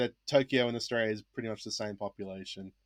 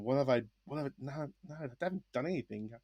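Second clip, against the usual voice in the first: inner worried mumble; 11 dB quieter, faster than usual. Retold or imitated. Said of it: "going through my head going".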